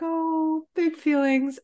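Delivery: high, overwhelmed oh; lightly hammed-up swell of feeling; a touch of tearful awe; mock emotional. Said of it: "I was just like".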